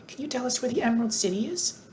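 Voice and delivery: a hint of polite young Dorothy. A narrator's reading. Dorothy voiced.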